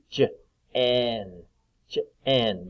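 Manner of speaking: Jen split into j and "en"; sounded out. Shown as a demonstration. Teacher asking short or long E, Jen sounded out. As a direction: say j, pause, then en with short E. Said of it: "That's the short E sound".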